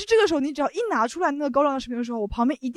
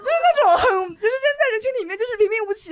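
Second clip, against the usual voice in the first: talking through high giggles; laughing; 10 semitones higher, 6 dB louder than usual. Real, not acted. Mocking a plain-looking critic, laughing hard. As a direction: laugh the words out, barely holding it. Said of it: "laughing while describing".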